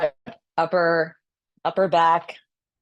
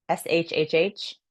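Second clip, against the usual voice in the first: long shhh. Shown as a demonstration. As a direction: hold the shhh out. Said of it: "the liver is sh".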